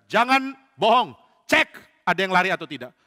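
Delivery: loud, emphatic royal command; stern order. Acted. Saul warning soldiers not to lie, commanding.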